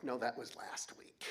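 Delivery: whispered, amused reply; softly whispered answer with a chuckle underneath; hushed owner. Retold or imitated. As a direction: hush it down, a little amused.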